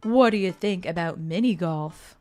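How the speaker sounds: deep, hearty dad voice; low, chummy guy voice; Brian. Acted. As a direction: play a deep-voiced buddy, not too bro-ish. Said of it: "Oh, yeah, it's deep".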